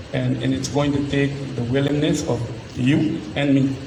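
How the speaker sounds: ordinary delivery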